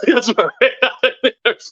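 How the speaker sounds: words swallowed by laughter; real laughter. Real, not acted. Amused by a viewer being direct, laughing. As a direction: agree while cracking up, pitch lifted.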